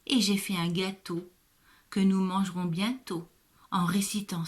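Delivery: reading a Mother's Day poem aloud to a pupil; poem recital. Acted. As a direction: recite the poem clearly and with feeling. Teacher reads poem aloud.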